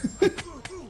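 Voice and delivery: high wheezy laugh. Real, not acted. Laughing mid retelling.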